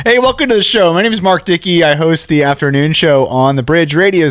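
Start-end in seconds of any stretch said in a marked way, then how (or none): 0.1-2.4 high bright host welcome; upbeat host intro. Acted. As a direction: announce the show with energy. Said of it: "No show".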